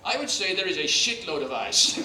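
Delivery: loud, deadpan blunt answer; blunt Dutch skipper. Retold or imitated. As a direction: say it flatly, no filter. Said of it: "He goes".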